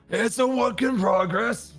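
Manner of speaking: put-on high, slow creaky old man. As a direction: play it as a doddering old man. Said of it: "I switch my old man voice".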